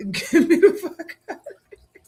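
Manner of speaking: a hammed-up furious yell broken by laughter; laughing while mimicking the angry boxer; mock angry shout, laughing. Mimicking the boxer to the chat, mock shout through laughs. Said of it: "He was mad saying".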